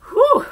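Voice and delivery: short, loud high whew; relieved whoop. Real, not acted. Reader after the book ends, loud relieved whew. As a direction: let out a high burst of relief.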